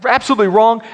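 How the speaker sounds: high, sharp scolding mom voice, fast at the end; scolding mother. Retold or imitated. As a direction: play the shocked mother catching her son. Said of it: "I remember her catching that and saying".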